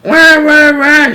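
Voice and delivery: high, loud imitated baby wail; baby bawling. A narrator's reading. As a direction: bawl "wah" like a screaming baby. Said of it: "bawling her head off".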